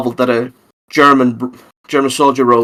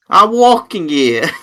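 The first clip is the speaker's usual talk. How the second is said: put-on Brooklyn accent; 5 semitones higher, slower than usual. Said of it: "trying to do a Brooklyn accent".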